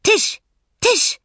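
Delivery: sehr hohe, laute Tierfigur; aufgeregter kleiner Pinguin. A narrator's reading. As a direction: quietschig hoch und begeistert ausrufen. Aufgeregter Pinguin spricht nach. (aufgeregte, hohe Pinguinstimme)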